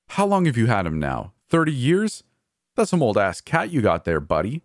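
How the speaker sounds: incredulous, pitch jumping up; mocking disbelief. A narrator's reading. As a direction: taunt with mock amazement. Mocking brother about imaginary cat.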